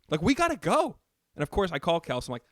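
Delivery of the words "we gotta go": higher, louder impatient urging. Retold or imitated. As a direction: push them to hurry up, irritated. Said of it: "Your boy was in a mood".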